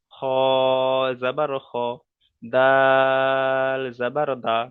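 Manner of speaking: slow recited drill, বা stretched out, then জাবার বা; measured teacher's chant with দাল drawn out; spelling drill. Shown as a demonstration. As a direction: hold each letter name long and level, then জাবার and its short "a" syllable. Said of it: "জাবার লাগিয়ে উচ্চারণ করলাম".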